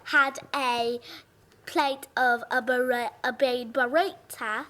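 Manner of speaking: British attempt sliding into southern twang; best British attempt. Shown as a demonstration. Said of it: "I'll try it in my best British".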